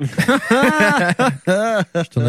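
slow, laughing through words. Real, not acted. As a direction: giggle out the confession. Confessing lost charger, laughing.

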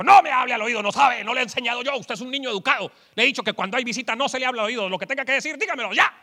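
loud, stern father scolding his son; mock angry dad telling off his kid. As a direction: scold the boy for whispering at the table. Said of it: "el papá le dijo".

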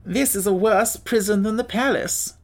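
sad. A narrator's reading. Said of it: "sadly remarked".

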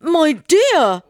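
high, loud cry through food; husband crying out round a big mouthful; mouth very full. A narrator's reading. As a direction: call out with cheeks stuffed. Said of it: "mouth so full that he could hardly speak".

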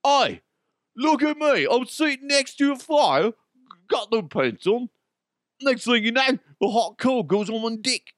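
high-pitched botched British impression; mock a British bloke, voice pushed way up; hammed-up, badly done British accent. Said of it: "I always screw up the British accent".

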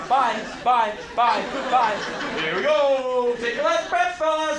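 loud, high singsong goodbye; mock cheerful farewell. Exterminator bidding the mice farewell, loud and cheery. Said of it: "a Zen thing".

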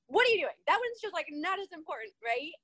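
high, exasperated snap at the husband; snappy. Retold or imitated. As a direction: snap at him, fed up. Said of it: "I would get snappy".

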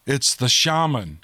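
usual speaking voice

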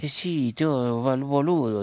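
high, loud Argentine "boludo"; Argentine accent. Acted. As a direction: imitate the pope's Argentine slang and lilt. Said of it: "porque él dice boludo".

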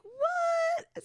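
high, loud, shocked squeal; incredulous, pitch shooting way up; freaking out. Retold or imitated. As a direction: shriek it in retold disbelief. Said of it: "I was freaking out".

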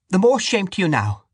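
put-on high, stern old woman; pious scolding woman. A narrator's reading. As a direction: rebuke them piously. Dante scolding Casey.

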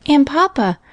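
put-on high little-girl voice; Minnie speaking. A narrator's reading. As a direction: pipe up like a small girl. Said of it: "said Minnie".

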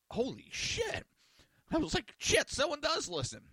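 high-pitched retold astonishment; amazed disbelief. Stunned that someone actually listens.

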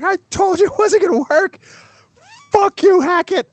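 high, loud furious yell; losing it. Retold or imitated. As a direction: explode at the coach who wouldn't listen. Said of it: "I lost my mind".

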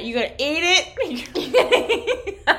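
high, slow question breaking into laughter; can barely get the words out for laughing. Real, not acted. Teasing her friend about the peeled ear skin, laughing hard.

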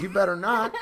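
laughing warning. Retold or imitated. Joking warning to friend.

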